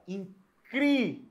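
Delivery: "incrível" broken up slowly, "cri" high; stress on CRI. Shown as a demonstration. Teacher repeating for students, stressed "cri" in "incrível". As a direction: separate syllables of "incrível", leaning on "cri". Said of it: "O cri é a sílaba tônica".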